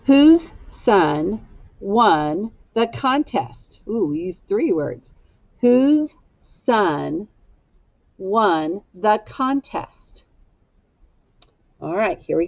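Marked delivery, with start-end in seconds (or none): 0.0-3.8 each word of "Whose son won the contest?" said very slowly and clearly; slow, careful classroom read; slow model sentence. Shown as a demonstration. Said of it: "Echo me". 8.2-10.2 "won the contest?" drawn out very slowly; slow teacher model; slow model phrase. Shown as a demonstration. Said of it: "Echo me".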